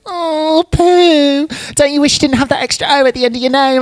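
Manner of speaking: very high, loud mocking voice; pestering mimicry. Pest taunting the lost Teletubby about its name, squeakily mocking. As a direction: tease in a squeaky, rushed pitch.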